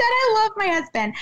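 a high, hammed-up sob; mock weepy voice, played for laughs; mock crying. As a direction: fake tears for a joke. Mocks her own post.

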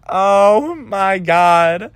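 long, high wail of dismay; drawn-out mock despair; exasperated dismay. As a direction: drag it out high, as if overwhelmed. Retold reaction to being screamed at.

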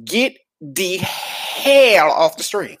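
drawn-out, high-pitched order; hammed-up spoiler warning; mock-stern warning. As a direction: playfully order them out, stretching it slowly. Mock-dramatic spoiler warning to viewers.

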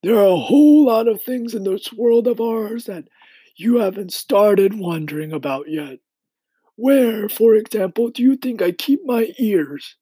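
high-pitched insect character voice; high, emphatic storybook elder; old grasshopper, kindly teasing. Acted. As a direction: quiz the boy in a high grasshopper voice.

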